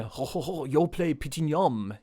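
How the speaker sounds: slow, badly faked French; mock French. Co-host copying the French ho-hos, badly faked French. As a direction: fumble through a cartoon French accent. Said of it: "French is easy".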